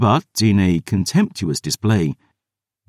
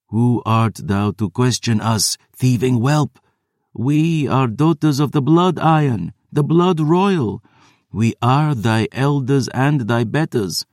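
higher, imperious undead sisters; haughty vampire elders; 6 semitones higher than usual. A narrator's reading. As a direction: look down on the thieving whelp. Vampire elders asserting royal blood.

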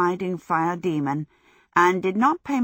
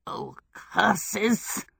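spat curse; 3 semitones higher than usual. A narrator's reading. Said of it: "Calcifer spat".

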